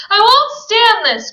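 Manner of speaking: high, loud anguished cry. Acted. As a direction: cry it out desperately. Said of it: "cried Daisy".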